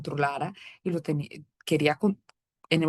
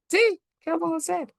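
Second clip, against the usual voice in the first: mock resignation; 11 semitones higher than usual. Mocking reply to mother-in-law.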